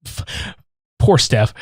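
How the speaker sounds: normal talking voice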